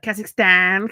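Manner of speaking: loud-ish mock Brummie "-stan", through the nose; nasal Brummie accent. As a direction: blurt Kazakhstan with an exaggerated Brummie twang. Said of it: "like, really nasally".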